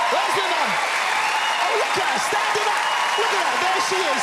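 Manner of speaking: high-pitched shout over applause; excited calling out. Real, not acted. Comedian showing off mum to the audience, excited high calling. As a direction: shout it high to the whole room.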